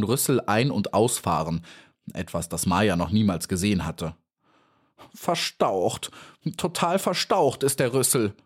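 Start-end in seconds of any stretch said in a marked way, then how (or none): 5.1-8.3 mildly wailed complaint, raised pitch; sorely aggrieved moan; pained cry. A narrator's reading. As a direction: lament an injury, a touch of agony. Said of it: "rief sie schmerzlich".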